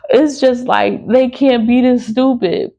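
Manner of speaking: can't believe how dumb they are; incredulous. Real, not acted. Disbelief at officials.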